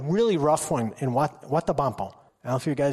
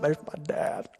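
quiet whispered reply; 3 semitones lower, 6 dB quieter than usual. Retold or imitated. Kid replies in story.

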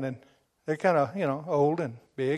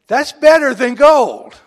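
loud, slow exclamation; booming pulpit line; 8 semitones higher, 14 dB louder, slower than usual. Acted. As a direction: hit it hard. Preacher's loud punch line.

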